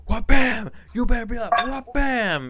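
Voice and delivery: shrill-high, loud mock scolding mom voice; hammed-up scolding mother, way up high and loud; mock angry mom. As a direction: yell at the kids like a furious mother, over the top. Wife voiced beating the kids.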